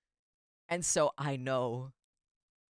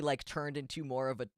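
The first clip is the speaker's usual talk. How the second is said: ordinary delivery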